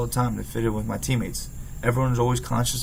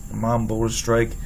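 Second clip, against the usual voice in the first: ordinary delivery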